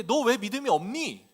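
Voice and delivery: loud, high scolding; acted rebuke. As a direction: scold your disciples in exasperation. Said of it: "라고 꾸짖으시는 거예요". (큰 소리로 높여 꾸짖듯)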